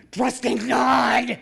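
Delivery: loud, slow, drawn-out shouted cry; retold scream. Terrified passenger screaming a prayer during turbulence, loud drawn-out shout. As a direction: bellow it out in panic on a bouncing plane. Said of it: "I was screaming at the top of my lungs".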